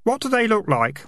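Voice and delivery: high-pitched, guileless youngster; innocent kid. Acted. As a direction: answer carefully in a high kid's voice. Kid questioning the highwayman.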